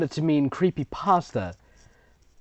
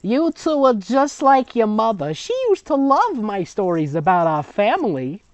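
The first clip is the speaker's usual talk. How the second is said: put-on high, sweet old grandma; grandmother voice; 8 semitones higher, 4 dB louder than usual. Reader voicing the grandmother to the girls, high and sweet. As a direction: read it as a doting grandmother. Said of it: "That's the grandmother".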